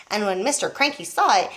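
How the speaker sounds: plain reading voice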